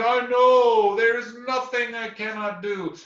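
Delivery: loud, high proud bear voice; boastful bear. Acted. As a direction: brush off the praise grandly. Bear boasts after swim.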